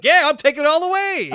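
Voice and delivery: high, loud goofy boast; imitated gleeful player. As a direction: crow it like an overexcited player. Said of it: "He was like".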